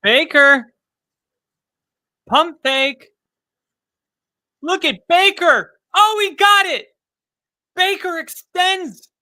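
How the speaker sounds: loud, high, thrilled calling of the play; excited play-by-play. Real, not acted. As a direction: yell the play as it happens. Live call of big play.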